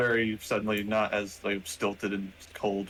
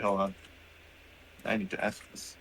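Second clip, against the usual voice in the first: ordinary talk, nothing marked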